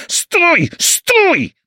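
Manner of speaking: very high, loud yell. A narrator's reading. Andy hailing an empty taxi in flight, high yell. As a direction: yell to halt a passing cab. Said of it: "wrzasnął".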